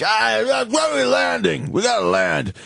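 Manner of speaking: high-pitched belligerent drunk impression; drunken yelling. Drunk businessman demanding that the plane land, belligerent high yell. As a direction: holler like a loaded passenger. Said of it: "So he's yelling".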